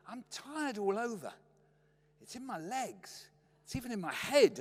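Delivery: weary complaint. Retold or imitated. Dad moaning to mum about tiredness, mock weary complaint. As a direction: moan it as if exhausted.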